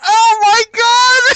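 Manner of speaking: high, loud laughing cry; laughing hard. Real, not acted. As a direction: lose it laughing. Cracking up at the clip.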